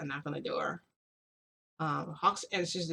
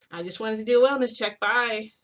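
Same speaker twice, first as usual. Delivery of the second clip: higher and louder, rushing to get away; hurried exit line; 5 semitones higher, 8 dB louder than usual. Retold or imitated. Narrator brushing Hawks off while hurrying to leave, higher and louder. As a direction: blurt it out and bolt for the door. I'm rushing toward the door.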